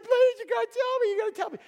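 high-pitched, hammed-up begging; nagging wife. Wife nags Samson to tell her, high pleading. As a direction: pester him in a squeaky high wifely voice. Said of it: "she just nags at him".

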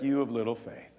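calm rebuke. Acted. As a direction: rebuke them quietly and calmly. Jesus calmly rebukes disciples.

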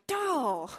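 put-on a playful "come on, girl" to your own past; self-scolding. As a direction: tease yourself like a fond friend. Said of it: "I'm like".

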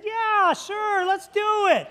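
high-pitched, gung-ho yes; feigned bravado. Retold or imitated. Pastor accepting a skydiving dare so as not to seem chicken, feigned bravado.

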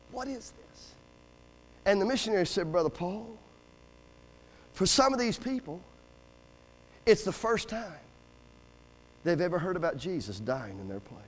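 grave, quiet reenactment of a missionary's answer; hushed retelling. Retold or imitated. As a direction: drop almost to nothing, with long solemn pauses. Awed exchange retold amid the wailing.

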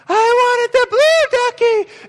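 hammed-up toddler shriek over the wrong toy; slow, shrill mock meltdown; mock tantrum scream. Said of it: "they just start screaming".

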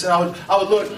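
normal talking voice

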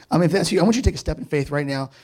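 ordinary delivery

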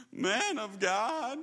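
begging. Acted. Third captain on his knees before Elijah, begging. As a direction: draw it out, pleading for mercy. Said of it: "he begged".

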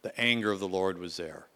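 normal talking voice

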